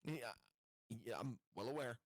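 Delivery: ordinary talk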